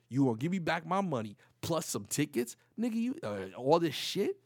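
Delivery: loud, incredulous retold challenge; back-then street talk. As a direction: lay out the deal like you don't buy it. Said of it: "I talk back then".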